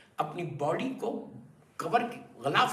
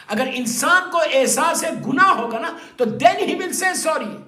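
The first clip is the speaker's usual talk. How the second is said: loud, high preaching; fiery preaching; 6 semitones higher, 8 dB louder than usual. Acted. Preacher rousing the gathering about pride and sin, loud and fervent. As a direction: drive the lesson home to the congregation.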